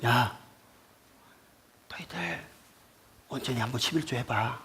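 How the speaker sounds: very soft, confiding mock offer; 하나님 말씀 흉내. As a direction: imitate God making a hushed, casual deal. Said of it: "지금 하나님께서 지금 이거를". (하나님이 은근히 제안하듯 아주 작게 흉내)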